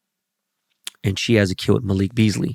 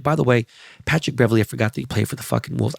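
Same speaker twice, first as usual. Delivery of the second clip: normal talking voice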